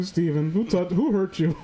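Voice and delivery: usual speaking voice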